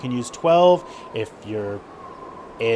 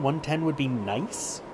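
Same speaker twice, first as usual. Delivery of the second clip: usual speaking voice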